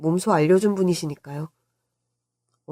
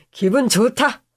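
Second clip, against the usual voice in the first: higher, exclaimed delight; elated uncle; 5 semitones higher, slower than usual. Acted. Uncle delighted by his nephew's praise, elated. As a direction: burst out pleased.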